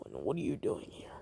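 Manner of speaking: hushed threat, quick; menacing whisper. Acted. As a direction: hiss the question low and fast. Kabuto's menacing follow-up.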